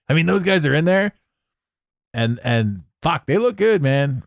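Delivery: ordinary delivery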